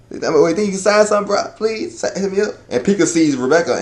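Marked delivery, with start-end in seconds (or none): 0.1-2.5 high-pitched fanboy pestering; gushing fanboy. Acted. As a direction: beg like a starstruck fan. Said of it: "is fanboy and say".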